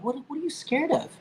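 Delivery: high-pitched, scoffing question; retold incredulous question; mock laughing disbelief. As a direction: imitate an amused cousin, voice jumping way up. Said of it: "he laughed and".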